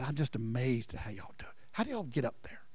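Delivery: hushed whisper, almost voiceless; boy whispering to classmates. Retold or imitated. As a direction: whisper it like a shy kid in awe. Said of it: "and I just said".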